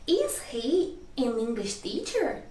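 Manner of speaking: slow "Is he an English teacher" with a questioning lilt; teacher's measured question tune on "Is he an English teacher"; question intonation. Shown as a demonstration. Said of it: "quando é pergunta é o mesmo tipo".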